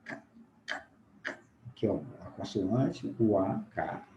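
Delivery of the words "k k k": "K" como estalo surdo, sem vogal; fonema K isolado. Shown as a demonstration. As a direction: solte só o som oclusivo de "K". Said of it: "o fonema K". ("K" seco e sem voz, repetido)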